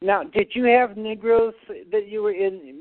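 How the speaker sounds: normal talking voice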